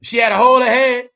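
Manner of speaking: hammed-up yell of disbelief; shouted punchline. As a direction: blurt it out at full volume.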